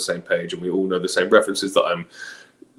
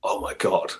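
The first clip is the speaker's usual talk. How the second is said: shocked whisper; 5 dB quieter than usual. Retold or imitated. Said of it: "which I was suddenly like".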